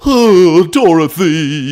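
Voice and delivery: loud, whiny crybaby wail; whimpering crybaby. Acted. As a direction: cry out like a spoiled baby. Imitating a crying baby.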